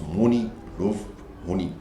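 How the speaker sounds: money love honey said as spelled, Geordie style; "love" with a rounded o, "honey" with no h; Tyneside pronunciation. Shown as a demonstration. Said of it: "pronounce the English words as they are spelled".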